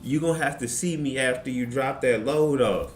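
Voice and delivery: high mock menacing sister voice; imitating the churchgoing sister going off; sister's threat. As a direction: threaten her sweetly but seriously. Sister threatening the pregnant lady.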